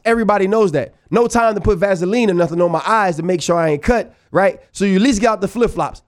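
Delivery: ordinary talk, nothing marked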